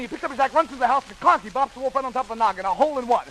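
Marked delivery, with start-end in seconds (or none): none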